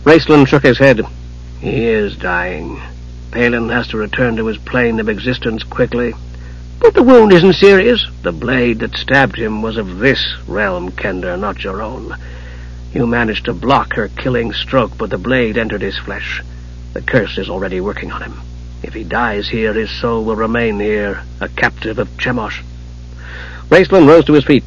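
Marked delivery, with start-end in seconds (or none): 1.6-6.1 soft, lowered sorcerer's voice; grave, quiet Archmage. A narrator's reading. Raistlin shaking his head over dying Palin, grave. Mage's held voice. 6.8-8.0 high, worried objection; protesting kender. A narrator's reading. Tass arguing that Palin cannot be dying, worried. 8.2-22.6 very soft, low, measured sorcerer; grave, cold Archmage. A narrator's reading. Raistlin explaining the curse to the kender, grave.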